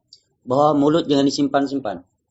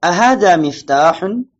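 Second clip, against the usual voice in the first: Ahadha miftahun slow, loud and higher, asked; "Ahadha miftahun" stretched out for repetition; question intonation; 4 semitones higher, 5 dB louder, slower than usual. Shown as a demonstration. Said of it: "Intonasi bertanya".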